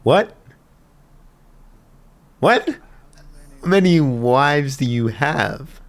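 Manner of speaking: high, loud disbelief; incredulous outburst. Real, not acted. Viewer shocked at how many wives, incredulous shout. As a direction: cry out in astonishment.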